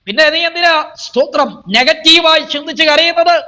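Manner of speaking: high, declaiming sermon voice; fervent preacher's declamation; full-force preaching. Acted. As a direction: proclaim it to the congregation on a high, sustained pitch.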